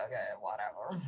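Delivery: low, soft bored shrug; low dismissive brush-off; dismissive whatever. Retold or imitated. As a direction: mutter it low, unbothered. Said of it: "I'm like".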